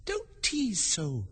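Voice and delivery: put-on high, soft, slow girl's voice; drawn-out little girl's plea; Alice pleading. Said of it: "said Alice".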